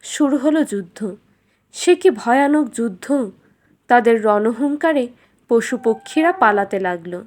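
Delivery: ordinary delivery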